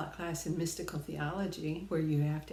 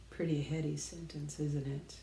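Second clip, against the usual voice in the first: ordinary talk, nothing marked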